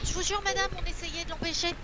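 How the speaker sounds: high, soft, apologetic voice; pleading to the landlady. Acted. Player's character excuses themselves to the landlady, pleading. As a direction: plead innocence, high and small.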